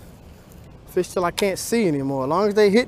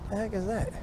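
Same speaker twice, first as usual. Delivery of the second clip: puzzled surprise; 5 semitones higher, 12 dB quieter than usual. Real, not acted. Something odd on the line.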